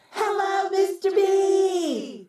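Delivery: high, slow sing-song class greeting; a class of kindergartners greeting together; kids calling in chorus. A narrator's reading. Kindergarten class greeting their frog principal in sing-song. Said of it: "called the students".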